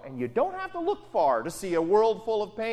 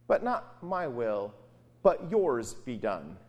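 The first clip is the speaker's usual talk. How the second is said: surrendering prayer; 10 semitones lower, slower than usual. Acted. Jesus yielding to God's will in the garden. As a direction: let go and give it over, gently.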